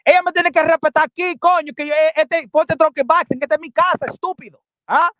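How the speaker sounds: loud, high-pitched indignant rant; angry scolding. Real, not acted. Scolding someone demanding respect.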